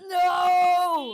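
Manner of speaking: loud dismayed shout. Real, not acted. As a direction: yell no in dismay. Dreading the announcement.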